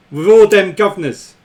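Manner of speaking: hammed-up Cockney accent; jokey, exaggerated Cockney accent; mock Cockney. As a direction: play a caricature East End Londoner's accent. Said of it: "imagine if I talk like that".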